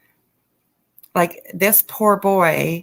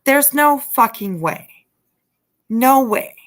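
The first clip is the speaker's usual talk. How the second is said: heated outburst; 5 semitones higher, 4 dB louder than usual. Real, not acted. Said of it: "I get so, like, heated".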